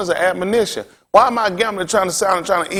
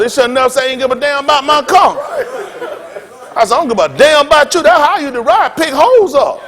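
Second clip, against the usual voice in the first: retold shouting; 7 semitones higher than usual. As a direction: throw it back at him, half laughing.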